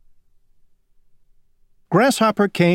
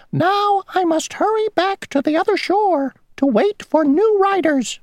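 very high, tiny, fussy insect voice; a small stickler of an insect stating the rule; mosquito voice. Acted. Mosquito ferryman laying down the rule to Grasshopper, tiny high voice.

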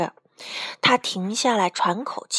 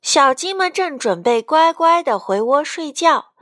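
plain reading voice

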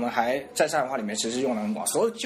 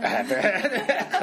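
laughing; 12 semitones higher, much slower than usual. Real, not acted. Laughing reaction to coy line.

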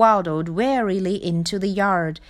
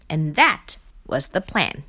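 usual speaking voice

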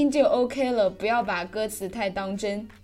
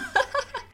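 a short, high burst of laughter. Real, not acted. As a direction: laugh at the punchline. Laughs after the punchline. (高声大笑)

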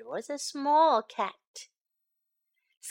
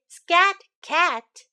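raised, slightly louder refrain; "Scat Cat" pitched higher; echoing the refrain; 6 semitones higher, 8 dB louder than usual. Acted. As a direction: echo the story's repeated line. Quoted phrase boy did not say.

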